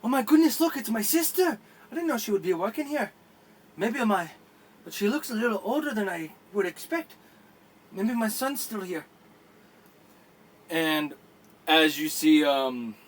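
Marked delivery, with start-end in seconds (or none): 0.0-9.1 high, excited surprise; Jamal spotting his sister, high and excited. Acted. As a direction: spot your long-lost sister, higher and thrilled. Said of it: "Jamal's like".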